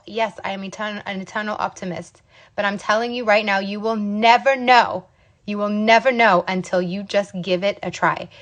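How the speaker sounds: ordinary talk, nothing marked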